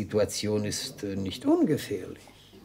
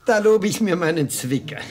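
ordinary talk

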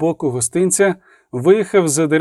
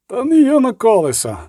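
high-pitched retort, moderately raised; shouting back; 8 semitones higher than usual. A narrator's reading. Wagoner answering Galada's order, shouting back. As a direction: call back in protest, pitched up. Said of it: "крикнув у відповідь візник".

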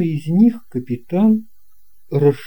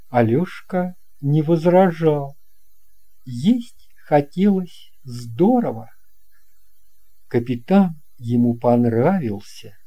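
usual speaking voice

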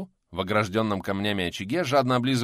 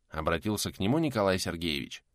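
ordinary delivery, nothing marked

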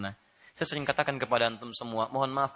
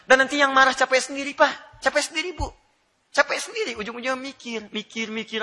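amused high preaching; 11 semitones higher, 6 dB louder than usual. Real, not acted. Laughing punchline to congregation.

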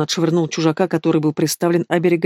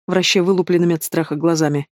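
plain reading voice

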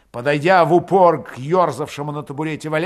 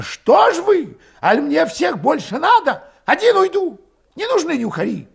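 high, loud, fuming tirade; impatient outburst; 7 dB louder than usual. A narrator's reading. Berating hesitant comrades who won't flee, exasperated. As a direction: berate them at the top of the voice.

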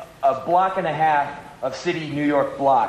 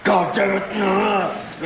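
mock fury; 4 semitones higher, much slower than usual. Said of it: "and they're like".

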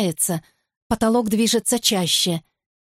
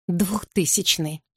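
usual speaking voice

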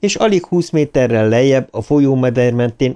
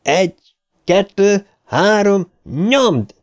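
high, evenly spaced count into a command; counting out a heave; 10 semitones higher, slower than usual. A narrator's reading. Richie counts for the friends lifting the lid, high rallying count. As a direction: call each number separately to time the push.

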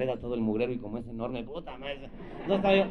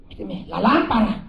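high, loud, slow mock plea; hammed-up lusty demand; mock moaning woman; 8 semitones higher, 9 dB louder, slower than usual. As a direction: drag it out like the woman next door.

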